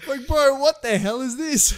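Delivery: high, slow, laughing disbelief; mock outraged shock. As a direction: ham up the horrified reaction. Mock reaction to the bidet.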